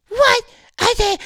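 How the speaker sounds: very high, loud mock wail; squealed imitation of crying. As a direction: burst into hammed-up tears. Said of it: "starts crying".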